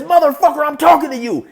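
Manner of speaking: loud, high furious yell; acted explosive temper; angry demand for attention. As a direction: shout at him to answer. Acted yell before the punch.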